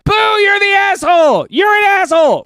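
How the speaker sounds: high loud booing shout; loud mock crowd jeer. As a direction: yell it like a whole arena booing. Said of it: "pointing at you and going".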